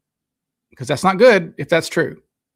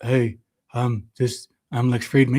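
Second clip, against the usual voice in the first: lower, monotone mock introduction; imitating Lex Friedman; 5 semitones lower, 4 dB quieter than usual. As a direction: introduce yourself like a droning podcast host. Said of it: "He just".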